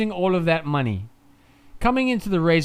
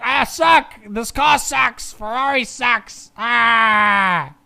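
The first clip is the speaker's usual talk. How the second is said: high, whining mock despair; drawn-out hammed-up complaint; Hamilton; 5 semitones higher, 3 dB louder, much slower than usual. Streamer imitating a driver griping about the car, hammed-up misery. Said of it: "he's like".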